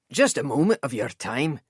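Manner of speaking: lightly voiced persuasive Scot; courteous Scotsman. A narrator's reading. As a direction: coax him gently. Elias figure voiced.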